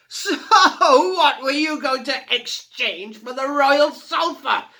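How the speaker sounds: loud, high, slow taunting roar. A narrator's reading. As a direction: bellow the question. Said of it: "he roared".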